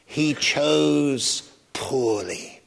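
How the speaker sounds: put-on slow, croaky old knight voice. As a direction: croak it out slowly like an ancient sentinel. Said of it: "in a wonderful croaky voice".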